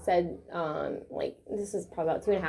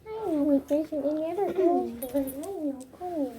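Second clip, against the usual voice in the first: soothing a scared dog; 4 semitones higher than usual. Real, not acted. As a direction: comfort him through the shot. Calming dog during injection.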